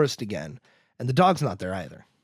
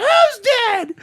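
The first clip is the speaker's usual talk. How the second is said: sudden high, loud yelp; over-the-top frantic kid yell; mock outburst; 8 dB louder than usual. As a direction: burst out in hammed-up alarm, pitched way up. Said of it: "he has some outbursts here".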